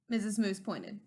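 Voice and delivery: ordinary delivery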